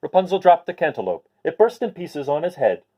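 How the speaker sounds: ordinary delivery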